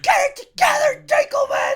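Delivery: high, furious mock scream; loud shrieked self-scolding; screaming at himself. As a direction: yell it at yourself in a nervous fit. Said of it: "just screaming at himself".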